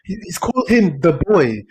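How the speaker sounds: ordinary talk, nothing marked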